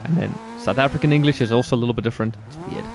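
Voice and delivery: normal talking voice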